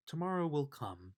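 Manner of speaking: ordinary delivery, nothing marked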